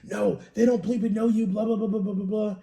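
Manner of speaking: mildly heated mock rant; mock going off. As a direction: imitate a partner jumping to your defense. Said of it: "she'd go off on them".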